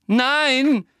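loud, high, agitated shout; upset. A narrator's reading. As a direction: cry out in heated protest. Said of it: "sagte Harry aufgebracht". (aufgebracht, laut gerufen)

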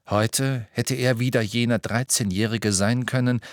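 ordinary delivery, nothing marked

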